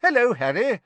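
high, cheery hello; bright greeting. A narrator's reading. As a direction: greet the boy brightly, pitch up. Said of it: "said Mister Weasley, brightly".

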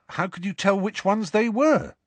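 high, curious youngster; questioning boy. Acted. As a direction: ask eagerly in a high voice. Ron questions Hagrid.

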